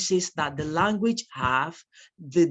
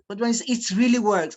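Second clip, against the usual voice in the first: excited slogan; 5 semitones higher, 4 dB louder than usual. Acted. Hyping the slogan.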